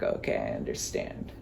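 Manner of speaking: low unvoiced whisper; whispered understanding. Realizing the boss's secret, hushed whisper. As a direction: breathe it out in a whisper. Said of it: "So then I was like".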